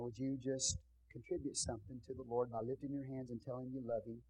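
normal talking voice

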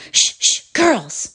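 Mary Beasley warns the class that the teacher is coming; loud warning. A narrator's reading. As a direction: hush the girls with a warning. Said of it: "she gave a loud warning".

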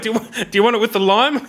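laughing while retelling offering a lime. Real, not acted. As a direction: giggle through it, barely holding it together. Laughing while quoting himself.

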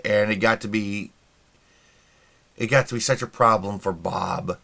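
normal talking voice

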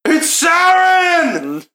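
high, drawn-out loud shout; yelling out. Acted. Guard alerts the whole town, yelling out. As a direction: yell the news across the town. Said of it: "he just yells out".

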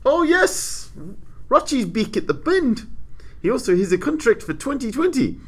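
delighted whoop about Rochy; excited. Real, not acted. Cheering driver's return.

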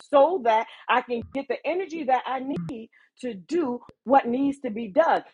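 normal talking voice

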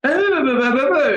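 very high squeaky blah-blah imitation; mock foreign gibberish. Passenger answering the guard without English, in squeaky mock gibberish. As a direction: parrot a confused foreigner's babble, way up high. Mimicking foreign man's confused reply.

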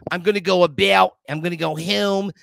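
demonstrating a Philly accent with stretched, fronted vowels; mock Philadelphia accent, punching the vowels. Shown as a demonstration. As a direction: lay on the Philly O's and OW's. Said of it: "The Philly accent is like".

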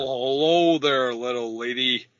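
slow Western cowboy drawl; Western accent. Pretend. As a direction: greet her like an old Western cowboy. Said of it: "That's, that's a Western accent".

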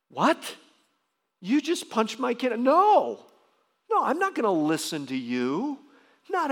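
high and loud, incredulous and furious; the angry dad refusing a favour; indignant outrage. Retold or imitated. Father refusing the man who hurt his child, loud indignant.

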